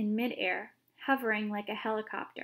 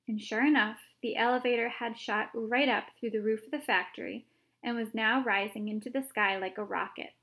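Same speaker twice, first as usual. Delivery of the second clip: ordinary delivery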